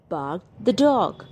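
usual speaking voice

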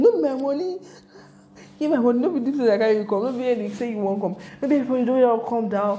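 fast mock shouting in Pidgin, driver demanding money; imitating angry yell. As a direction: hammer it out like a furious driver. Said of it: "Was yelling".

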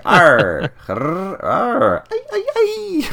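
high animal whimper rising to a yelp; mock whining. Podcaster imitating the heartbroken monster, mock whining. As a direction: imitate a sad wolf-man whining, high-pitched. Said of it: "He's just whining".

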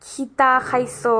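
lightly put-on Sylheti accent on Khita khaiso; Sylheti dialect. Showing viewers the Sylheti dialect, a light Sylheti accent. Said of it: "Bangladeshi dialect, which is very harsh".

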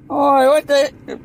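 high, drawn-out mock Irish cry; hammed-up Irish accent cut short. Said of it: "that funny accent".

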